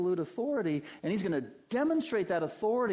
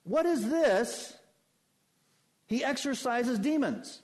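high, slow astonished question; amazed crowd; 9 semitones higher, 4 dB louder than usual. Acted. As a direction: wonder aloud in awe. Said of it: "they questioned among themselves, saying".

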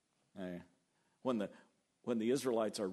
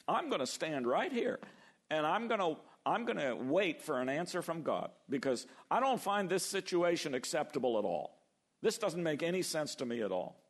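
determined Habakkuk; 6 semitones higher than usual. Retold or imitated. Habakkuk's resolve voiced.

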